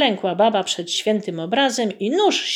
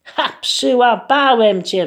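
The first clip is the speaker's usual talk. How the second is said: mild devil's yell; 4 semitones higher, 5 dB louder than usual. Acted. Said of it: "wrzasnął diabeł".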